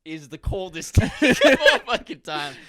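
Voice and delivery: loud and laughing; laughing loudly. Real, not acted. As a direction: crack up through the line. Laughing while praising.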